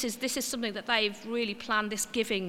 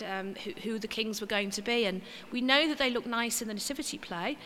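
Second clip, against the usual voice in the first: ordinary talk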